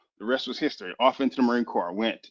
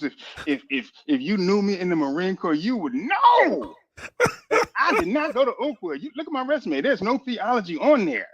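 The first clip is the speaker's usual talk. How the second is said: emphatic testimony; 8 semitones higher than usual. Real, not acted. Chaplain setting the record straight for viewers, emphatic testifying.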